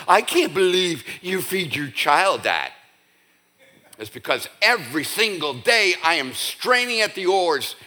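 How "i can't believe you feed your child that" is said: higher-pitched mock disapproval; mock-scandalized parent; judgmental parent. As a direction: imitate an appalled, judging parent. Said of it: "all the other holistic parents are looking at you".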